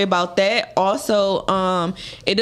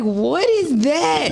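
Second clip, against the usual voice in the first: slow, higher, baffled "what is that"; confused; 7 semitones higher, much slower than usual. Retold or imitated. She hears the "tens" slang and asks, slow and baffled. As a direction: drag it out in disbelief. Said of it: "I'm like".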